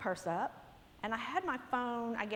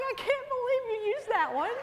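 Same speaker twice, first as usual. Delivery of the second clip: laughing; 12 semitones higher, 4 dB louder than usual. Retold or imitated. Friend laughing at her.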